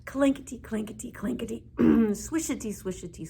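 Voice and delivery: ordinary delivery, nothing marked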